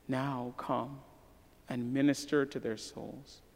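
soft, low, fading prayer voice; closing prayer. Acted. Pastor praying for his people at the end of the sermon, hushed. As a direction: pray quietly over the congregation.

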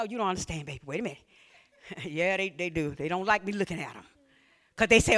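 normal talking voice

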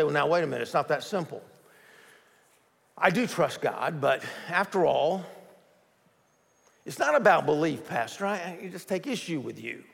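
a hint of a skeptical churchgoer pushing back; doubting listener. Preacher voicing a worried listener questioning him, mildly hesitant. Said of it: "you say".

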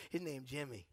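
low, quiet mumbled answer; Larry's reply. Retold or imitated. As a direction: drop low and mutter the name. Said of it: "He goes".

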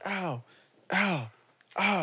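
high, fake cries of pain; fake yelps of pain. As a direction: ham up the "ow" like it hurts. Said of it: "I was faking too".

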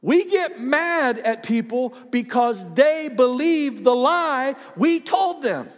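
high, slow declaration; emphatic preaching. Real, not acted. Preacher drives home key point.

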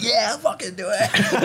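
put-on drunk woman. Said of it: "and she was like".

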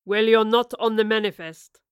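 calm threat from a weathered captain; captain coldly questions the stowaways; fearsomely calm captain. A narrator's reading. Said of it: "the captain said in a fearsomely calm tone".

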